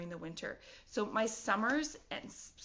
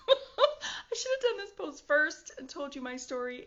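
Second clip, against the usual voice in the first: laughing; 6 semitones higher, 5 dB louder than usual. Real, not acted. Laughing at own mistake.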